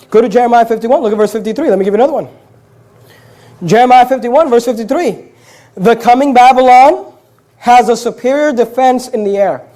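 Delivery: usual speaking voice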